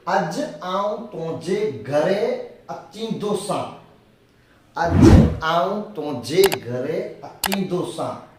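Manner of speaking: slow and clear, every syllable given its weight; slow Kutchi dictation. Shown as a demonstration. Teacher modelling the Kutchi sentence slowly. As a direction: lay the sentence out slowly for learners. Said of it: "teacher models Kutchi sentence".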